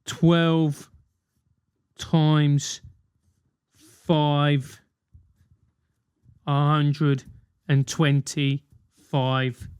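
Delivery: very slow, each word dragged out with long pauses; slow struggling kid. Retold or imitated. A grown man learning automatic mimics a slow schoolkid doing sums, dragged-out and halting. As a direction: plod through the sum like a struggling child.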